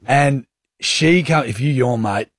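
ordinary talk, nothing marked

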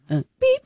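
high squeaky beep; imitated car horn. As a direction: mimic a friendly toot. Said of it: "this is how I imagine my toot to go".

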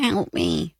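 low, feeble man-flu plea; mock invalid calling from the couch; whiny sick man.

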